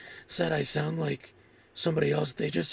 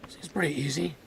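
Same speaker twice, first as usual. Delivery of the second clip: whispering. Real, not acted. Quiet aside to stream.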